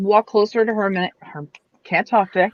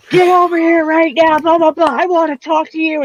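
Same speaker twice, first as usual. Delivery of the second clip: loud, high, fake-angry command; mock angry yelling; 8 semitones higher, 4 dB louder than usual. Player yelling at the villager to fool Romans, loud fake anger. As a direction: yell at her to sell the act to the Romans. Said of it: "I'm just be like".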